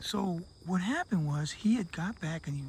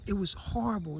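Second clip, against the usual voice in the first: usual speaking voice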